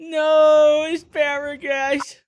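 high, stretched-out disbelief; horrified at the wasted asparagus; dismayed surprise. Real, not acted. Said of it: "I know".

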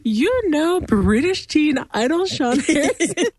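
high, slowed-down mock amazement; imitating Rhea. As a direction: quote it as an impressed cartoon character, high and drawn out. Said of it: "Rhea uses his entire title".

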